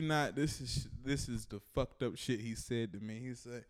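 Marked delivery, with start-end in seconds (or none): none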